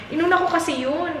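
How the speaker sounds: ordinary delivery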